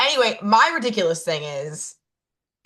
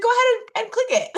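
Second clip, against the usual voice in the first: mock patient agent; 14 semitones higher, much faster than usual. Agent's final instruction, high voice.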